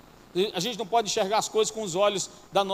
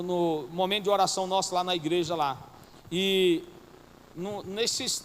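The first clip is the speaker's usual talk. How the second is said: ordinary delivery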